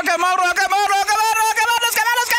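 high, rising frantic chatter; frantic cries. Real, not acted. Player spots another enemy, frantic cries.

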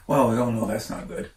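plain reading voice

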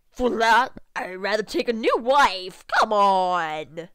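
loud, forceful character voice; loud acted retort. Angry man refusing to trade his house, loud retort. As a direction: throw it back at full volume.

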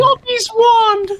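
very high squeaky house-elf voice; Dobby voice. Pretend. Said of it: "Dobby wishes".

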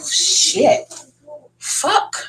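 a sharp, voiceless swear, pitched high; breathy curse. Real, not acted. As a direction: let it slip out as a stung gasp. Onion juice stings her eyes.

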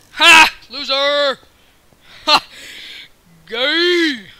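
loud gloating shout, high; taunting yell. Real, not acted. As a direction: yell the taunt at the beaten player. Taunting an opponent in game.

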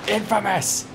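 excited announcement. Real, not acted. Announcing game title.

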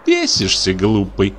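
ordinary delivery

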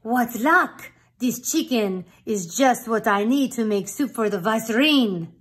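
loud, gleeful cook; delighted cook. Acted. Cook spots the rooster for soup, loud and gleeful. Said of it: "The cook who saw him said".

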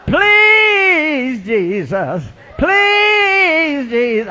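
high drawn-out wail, sliding down; crying voice. Retold or imitated. Lady praying by the altar, mock crying wail. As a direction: imitate a long, pleading cry. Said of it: "a crying voice".

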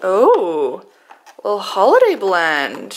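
normal talking voice

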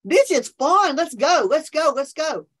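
high, loud joyful whoop, then a rallying cry; let's go, let's go with a big whoop; excited whoop. Retold or imitated. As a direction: cheer like your spirit is jumping. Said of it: "Your spirit starts to go".

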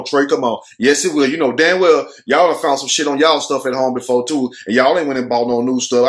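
usual speaking voice

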